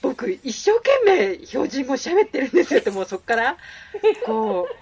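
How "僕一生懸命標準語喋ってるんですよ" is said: higher-pitched imitation of a man protesting; flustered protest. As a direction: play someone found out, pleading his effort. Acts the exposed man's protest. (慌てて弁解する人の真似)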